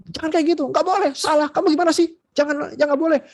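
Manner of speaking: high-pitched mock scolding of a child; imitating a parent shouting at a child who did wrong; angry scolding. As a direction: play a parent telling off a kid. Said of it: "Tapi kita marahin".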